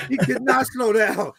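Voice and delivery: ordinary delivery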